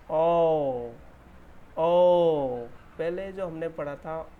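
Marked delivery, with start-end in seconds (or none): 0.1-2.7 ओ held long and loud. Shown as a demonstration. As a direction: stretch the ओ open. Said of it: "इसका हम किस sound से जानते हैं".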